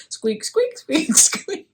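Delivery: high squeak, squeak; squeaky toy noises. Acted. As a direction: imitate a rubber duck squeaking. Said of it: "That's my rubber duck voice".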